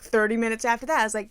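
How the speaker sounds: ordinary talk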